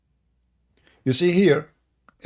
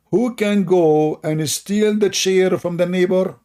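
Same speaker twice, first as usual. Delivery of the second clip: slow, raised mocking king voice; mock king's order; 7 semitones higher, 4 dB louder, slower than usual. Mocking Sulaiman as he orders his men to steal, slow and raised. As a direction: ham up a king ordering a theft.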